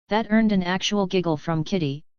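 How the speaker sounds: usual speaking voice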